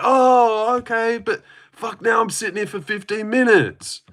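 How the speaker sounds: high-pitched put-on letdown; mock dismay. Viewer reacting to a fight over too soon, mock dismay. Retold let-down at early knockout.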